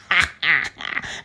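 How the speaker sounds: short, spaced-out cackle; laugh. Real, not acted. Podcaster laughs at her own boast, mock cackle. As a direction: laugh in separate low bursts.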